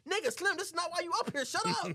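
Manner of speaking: high, hurried telling-off; mock exasperated scolding. Mike scolds Slim for setting off the bride, high exasperation. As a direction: tell Slim off, voice shooting up.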